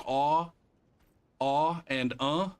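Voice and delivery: "aw" drawn out long and open, then a short "uh"; vowel demo. Shown as a demonstration. Demonstrating French vowels to a friend, slow and careful. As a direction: hold the "aw" slowly, then snap a clipped "uh". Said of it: "doesn't French have both of those vowel sounds".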